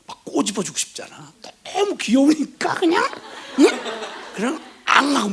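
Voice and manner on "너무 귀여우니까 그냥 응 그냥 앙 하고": gushing over cuteness. Acted. Gushing over cute baby.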